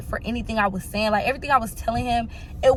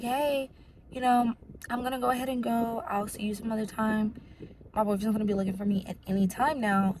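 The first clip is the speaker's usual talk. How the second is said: soft excuse; 7 dB quieter than usual. Retold or imitated. Woman telling him she must go, softly easing out. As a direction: excuse yourself softly and casually. Trying to slip away calmly again.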